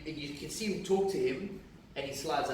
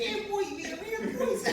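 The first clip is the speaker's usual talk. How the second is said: very high, squeaky Kiwi voice; Kiwi accent. Retold or imitated. Mimicking Kiwi blokes.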